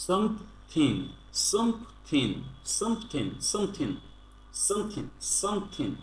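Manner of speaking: "something" ending in "-thin" with no ng sound; teacher models "something" slowly as "some-thin"; dropped final g. Shown as a demonstration. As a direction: break "something" into two syllables, some-thin. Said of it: "ইং বলবো না".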